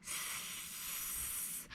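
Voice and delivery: long, soft hiss; drawn-out pretend snake sound. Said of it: "snakes all hissing".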